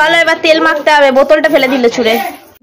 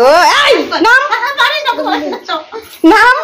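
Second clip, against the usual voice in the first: ধমকের ডাক; 9 semitones higher, much slower than usual. Real, not acted. Ordering the children to get down. (উঁচু গলায় বাচ্চাকে নামতে বলা)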